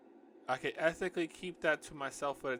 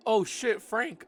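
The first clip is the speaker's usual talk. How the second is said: high, loud jolt of realization; startled; 9 semitones higher, 8 dB louder, slower than usual. Acted. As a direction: blurt it as if suddenly remembering. Character's startled thought while reading.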